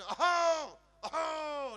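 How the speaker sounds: two loud desperate hacks; repeated throat clearing. Retold or imitated. Uncle yelling hacks at the stuck window, loud. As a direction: keep hacking, the window won't open. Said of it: "રાડ્યું પડે".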